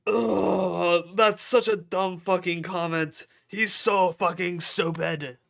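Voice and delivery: loud high frustrated groan; angry. Real, not acted. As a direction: vent like you're fed up. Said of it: "I'm getting fucking mad".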